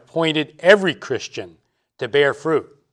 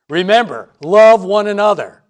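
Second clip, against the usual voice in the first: loud, high, insistent reminder; emphatic command; 7 semitones higher, 9 dB louder than usual. Acted. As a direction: drive it home like a parent's repeated warning. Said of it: "left us with this emphatic command".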